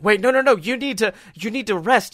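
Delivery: imitating frantic nurses; alarmed nurses. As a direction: stop the patient getting up. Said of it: "the nurses are like".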